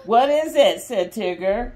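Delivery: lively, expressive picture-book reading; mother reading a Disney story to restless kids, lively and expressive; reading to children. Acted. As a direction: read it out big for the little ones. Reads story aloud to kids.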